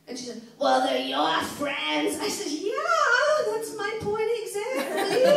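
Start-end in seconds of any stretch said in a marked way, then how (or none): 0.6-2.2 put-on much lower, slow raspy old smoker's retort; raspy smoker mom. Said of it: "that really raspy smoker's voice". 2.5-5.2 high, loud, slow "point exactly"; triumphant retort. Retold or imitated.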